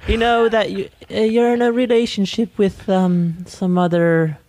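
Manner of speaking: high, put-on celebrity voice; Eddie Murphy voice. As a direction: pitch it way up as Eddie. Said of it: "Why do you sound like this?".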